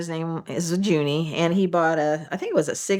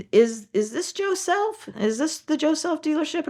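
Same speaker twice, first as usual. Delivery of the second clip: put-on old man voice; 11 semitones higher than usual.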